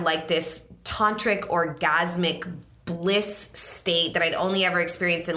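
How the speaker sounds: normal talking voice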